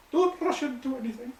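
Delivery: high-pitched mock Scottish coach; Scottish accent. Quoting a coach's warning, high Scottish voice. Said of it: "the old Scottish voice".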